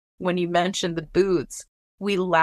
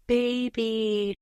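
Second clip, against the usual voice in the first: higher, lightly mocking crooning "Baby"; eerie sweet-talk; 6 semitones higher than usual. Ex mocked for sweet-talking her at Christmas, eerie croon. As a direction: imitate his creepy tenderness.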